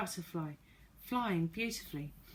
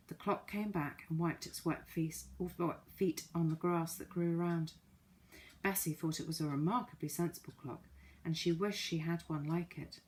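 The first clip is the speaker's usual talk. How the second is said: ordinary delivery, nothing marked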